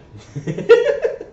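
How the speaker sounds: high sinister giggle; creepy clown giggle. Retold or imitated. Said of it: "It ended up sounding like a creepy clown voice".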